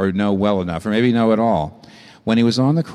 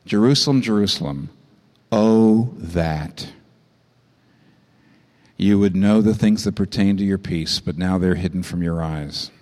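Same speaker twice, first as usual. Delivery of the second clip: weeping lament; 5 semitones lower than usual. Acted. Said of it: "begins to weep".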